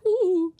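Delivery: high-pitched mock-scared "ooh"; frightened coward's "ooh". Host mimicking cowardly Luigi in the mansion, mock-scared high "ooh". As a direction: imitate a cowardly hero's high wail. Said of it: "And he's just like".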